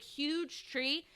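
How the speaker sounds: ordinary delivery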